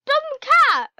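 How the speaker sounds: loud exasperated jab. Real, not acted. Player annoyed at her cat, exasperated. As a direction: huff at the silly cat.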